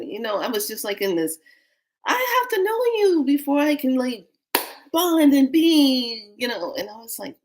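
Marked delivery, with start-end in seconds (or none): 2.1-6.3 mock inner protest. Newcomer inwardly resists bonding with strangers, mock wailing protest. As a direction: stretch it into an exaggerated complaint.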